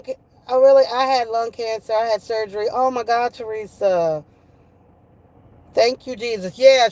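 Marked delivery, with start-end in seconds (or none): none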